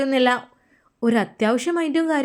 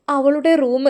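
usual speaking voice

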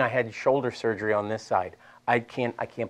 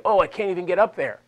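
high-pitched imitation of struggling students; hammed-up "I can't" whine; mock complaint; 7 semitones higher, 4 dB louder than usual. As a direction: play a struggling student protesting. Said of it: "some of you are gonna be like".

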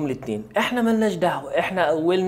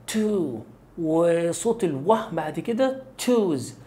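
normal talking voice

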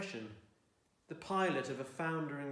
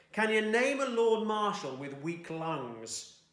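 higher, louder, slow taunting question; mocking brother; 8 semitones higher, 7 dB louder, slower than usual. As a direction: needle him like a scornful older brother. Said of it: "his brother used to ask".

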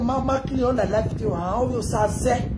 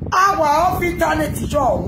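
1 shouted catchphrase; 5 semitones higher, 5 dB louder than usual. Real, not acted.

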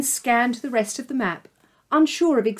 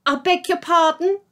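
receptionist voice; 8 semitones higher, 4 dB louder than usual. Acted. Receptionist puzzled on the phone.

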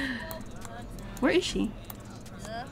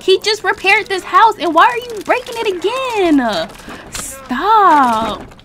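scolding; 8 semitones higher, 6 dB louder, slower than usual. Acted. Scolding a sim wrecking house.

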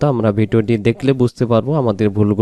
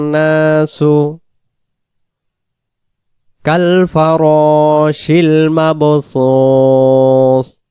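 slow melodic tilawat with long drawn vowels; slow recitation; 3 semitones higher, 4 dB louder, much slower than usual. Shown as a demonstration. Teacher recites the closing verses slowly for students. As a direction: chant every word slowly. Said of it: "র জবর র".

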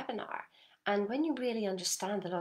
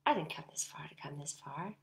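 lower, whispered inner resolve; quiet resolve; 5 semitones lower, 5 dB quieter than usual. Shown as a demonstration. Coach demonstrating the inner resolve to listeners, whispered and low. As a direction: say it under your breath, determined. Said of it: "this resolve inside yourself that goes".

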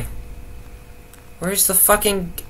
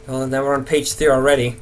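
ordinary delivery

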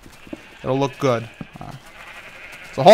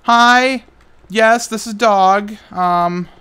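mock timid; 6 semitones higher, 4 dB louder, much slower than usual. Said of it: "and I'm like".

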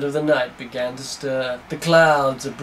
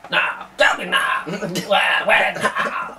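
mock shouted interrogation; 4 semitones higher, much slower than usual. Hammed-up interrogation, half shouted.

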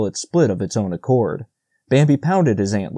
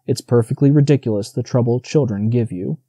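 ordinary delivery, nothing marked

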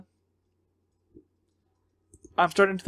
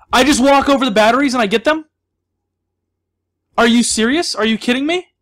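loud, higher, indignant disbelief; incredulous; 5 semitones higher, 6 dB louder than usual. Real, not acted. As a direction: question it as if you can't believe it. Disbelief at how simple it was.

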